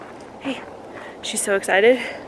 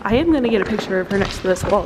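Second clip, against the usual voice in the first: normal talking voice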